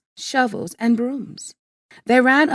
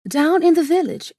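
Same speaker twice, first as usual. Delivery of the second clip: ordinary delivery, nothing marked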